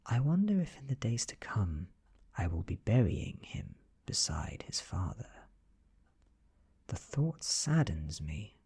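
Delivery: plain reading voice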